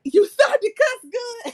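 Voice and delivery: high, loud laughing delivery; real laughter breaking into the words; cracking up. Real, not acted. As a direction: burst out laughing as you read it. Cracks up reading a comment.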